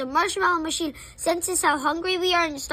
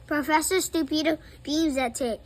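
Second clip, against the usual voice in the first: ordinary delivery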